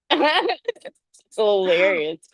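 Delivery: normal talking voice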